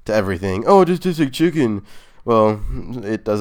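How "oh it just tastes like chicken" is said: mocking everyone. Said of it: "just like everyone says".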